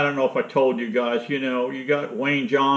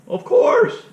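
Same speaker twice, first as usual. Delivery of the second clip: much higher, louder mock reply; squeaky old Jim; 6 dB louder than usual. Friend on the phone answers the question in a high mock voice.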